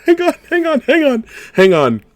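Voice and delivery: high, loud squealing hang on; excited shriek. Real, not acted. Same words shrieked.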